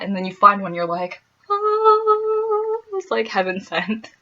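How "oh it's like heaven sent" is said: high, bright gasp of relief; light up as if you found a friend; a soaring thrilled oh; delighted oh. Retold or imitated. Spotting a fellow Midwesterner.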